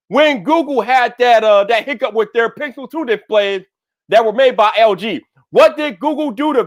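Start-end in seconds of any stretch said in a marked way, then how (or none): none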